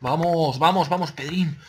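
loud, high urgent cheering; excited shouting. Real, not acted. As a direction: push yourself through the fight. Fighting the last opponent.

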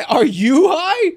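real disbelief at the chat; incredulous. Real, not acted. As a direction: stretch it out in shock. Disbelief at chat's advice.

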